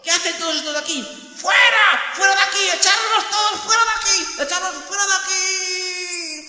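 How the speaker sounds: very high, loud shrieks; frenzied screaming. Acted. Singer shrieking to expel the outcasts. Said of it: "También decía".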